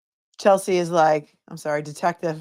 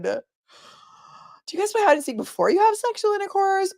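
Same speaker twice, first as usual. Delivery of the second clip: high, incredulous mock repeat; 11 semitones higher than usual. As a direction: parrot it in disbelief. Repeats the question again, mocking.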